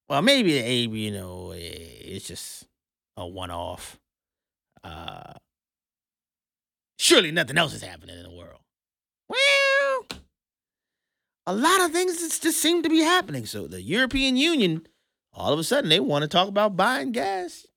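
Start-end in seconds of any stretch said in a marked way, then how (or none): 0.1-8.6 mock dismissive. Mock dismissive voice, dropping low. 9.3-13.5 mock innocent. Sarcastic high mock innocence.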